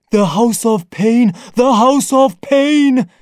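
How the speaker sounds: loud, high ape-man chant; excited creature shouting; excited cry. Acted. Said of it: "cried the ape man in excitement".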